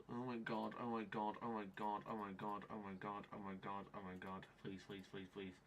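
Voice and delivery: low, soft repeated pleading; hushed, sinking murmur of despair; desperate muttering. Real, not acted. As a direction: chant it under your breath in dread. Murmured begging as fix fails.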